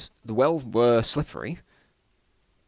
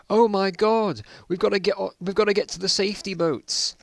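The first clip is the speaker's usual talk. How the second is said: panicked cry; 9 semitones higher than usual. Acted. Character panics as boat sinks.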